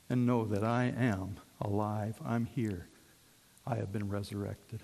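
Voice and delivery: gentle. Acted. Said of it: "Christ very gently says".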